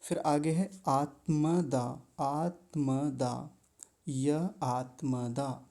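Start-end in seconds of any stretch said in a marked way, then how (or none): 0.9-3.5 आत्मदा spelled out slowly, each syllable distinct; slow clear आत्मदा. Shown as a demonstration. Teacher to students, slowly sounding out आत्मदा. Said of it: "दोनों को अलग बोलेंगे". 4.1-5.7 य spoken fully, a gap, then आत्मदा slowly; य and आत्मदा kept apart. Shown as a demonstration. Teacher showing students to keep य आत्मदा apart. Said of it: "दोनों को अलग बोलेंगे".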